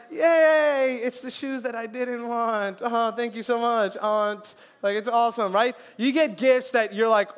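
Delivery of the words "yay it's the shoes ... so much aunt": mock cheer, higher and louder, slowing on the shoes; hammed-up fake gratitude; sarcastic thanks. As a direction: overdo the thank-you to an aunt. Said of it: "Or you're like".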